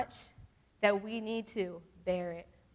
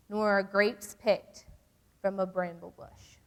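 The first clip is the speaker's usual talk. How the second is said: scripture reading; 4 dB louder than usual. Acted. Reads scripture aloud.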